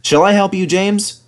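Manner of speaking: high, calling out helpfully; Edward calling. A narrator's reading. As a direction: call up the line to a friend. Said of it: "called Edward".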